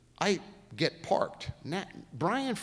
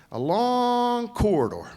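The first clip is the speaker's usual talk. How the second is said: slow, higher, louder put-on boss voice; imitating the boss's slow, drawn-out line to a driver; 10 semitones higher, 6 dB louder, slower than usual. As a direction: stretch it out like the stern old boss. Imitating Mr France's slow drawl.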